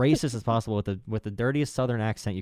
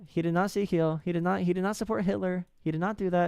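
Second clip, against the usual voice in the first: higher-pitched mimicked apologist; mock denial; 8 semitones higher than usual. Musk defenders denying the salute, mock insistent. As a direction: lightly act out an insistent defender.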